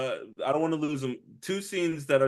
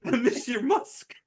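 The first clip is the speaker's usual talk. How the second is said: mock tearful; 9 semitones higher than usual. Champ breaking down quote.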